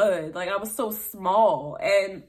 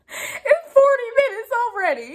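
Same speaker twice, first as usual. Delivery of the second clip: laughing; 14 semitones higher, 5 dB louder than usual. Real, not acted. Laughing at video length.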